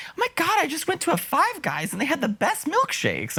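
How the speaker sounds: high excited gush; gushing excitement. Retold or imitated. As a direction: imitate her giddy rave way up high. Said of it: "she was like".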